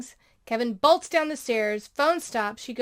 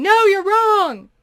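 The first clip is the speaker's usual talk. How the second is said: high, loud, drawn-out mock scream; imitated screaming; 9 semitones higher, 12 dB louder, slower than usual. Girl arguing against evolution at the museum, mock screaming. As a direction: scream it slowly as stubborn denial. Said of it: "She just kept screaming".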